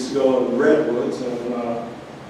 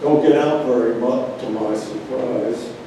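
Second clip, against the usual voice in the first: usual speaking voice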